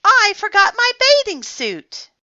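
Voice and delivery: high, loud wail of dismay; crying out. A narrator's reading. Froggy missing his bathing suit, dismayed cry. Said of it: "cried Froggy".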